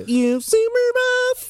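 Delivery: very high, slow squeaky voice. Acted. Hammy tagline after ad banter.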